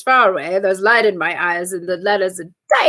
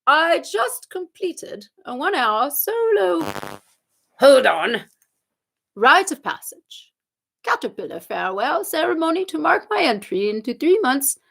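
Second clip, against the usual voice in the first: hammed-up fake English reading; terrible English accent. Host reads a British viewer's comment, terrible English accent. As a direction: read it out in a bad English accent. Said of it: "I'm going to do it in this accent".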